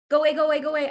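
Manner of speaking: loud, high, frightened pleading to make the voice leave; panicked go away. Retold or imitated. Thirteen-year-old girl shouting at a ghostly voice, scared and loud. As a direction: push the spirit voice away in alarm. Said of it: "And I actually went like this".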